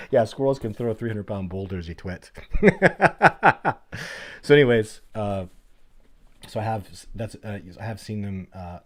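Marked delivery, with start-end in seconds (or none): 2.3-4.4 loud, high rolling laughter; real mocking belly laugh; long laugh. Real, not acted. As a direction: keep laughing hard at the silly idea. Laughing after calling him a twit.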